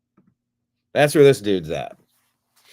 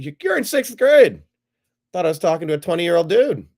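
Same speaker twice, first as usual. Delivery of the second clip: high, loud disbelieving "sixth grade?"; surprised; 7 semitones higher, 4 dB louder than usual. Real, not acted. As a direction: caught off guard, pitch jumps right up. Learns caller is a child.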